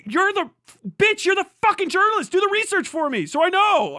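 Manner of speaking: real anger at a lazy journalist; furious berating. Real, not acted. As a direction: tear into the reporter.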